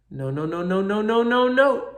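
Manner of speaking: no's climbing from plain to high and loud; building protest. Retold or imitated. Imitating his sedated self protesting as the wrist is pulled, building to a yell. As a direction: escalate the no's like pain is mounting.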